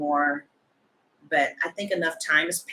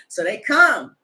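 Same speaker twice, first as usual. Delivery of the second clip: usual speaking voice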